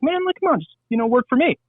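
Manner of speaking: high-pitched retold plea, easing down; coaxing buddy. Buddy offers an out-of-work friend a job, coaxing. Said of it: "He's like".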